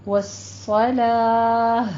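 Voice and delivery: والصلاة slow, heavy ص, stopping on ہ; tajweed demo. Shown as a demonstration. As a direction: recite والصلاة with full ص and end in ہ. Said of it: "ہا میں بدل دیں گے". (والصلاة پر وقف، ص پر، آخر میں ہا)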